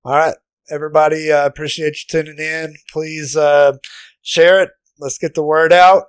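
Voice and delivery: loud, upbeat host outro; energetic podcast host goodbye; show sign-off. Acted. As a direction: pump up the audience to share. Host's sign-off to viewers.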